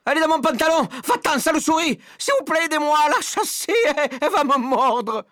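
high, loud, stammering hysteria; frantic crying out. A narrator's reading. Stupid cook afraid of a mouse bite, crying out. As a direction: wail and beg for help in terror. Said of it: "le stupide cuisinier crier encore".

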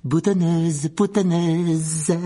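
high, loud, drawn-out jeer; poltergeist's shrieked taunt; taunting shout. A narrator's reading. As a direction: yell it mockingly, stretching the last word. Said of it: "en criant".

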